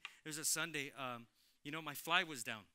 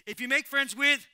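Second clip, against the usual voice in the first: louder build; 8 semitones higher, 18 dB louder than usual. Real, not acted.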